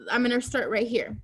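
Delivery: ordinary delivery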